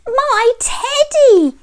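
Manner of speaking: very high, loud, slow cry; little boy's cry. A narrator's reading. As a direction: call out high for a beloved toy. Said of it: "said Eddie".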